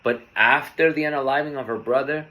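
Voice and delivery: ordinary delivery, nothing marked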